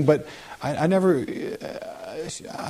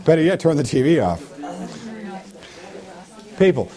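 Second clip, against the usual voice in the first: higher, urgent preacher's rebuke; fiery preaching; 6 semitones higher than usual. Acted. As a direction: rebuke the congregation, pitch up. Preaching builds to full force.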